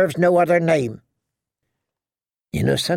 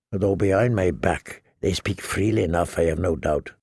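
lower, quieter, dry aside; sardonic aside; 7 semitones lower, 4 dB quieter than usual. Acted. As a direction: drop into a dry sneer. Jamie's low sardonic aside.

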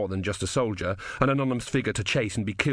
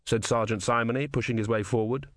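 usual speaking voice

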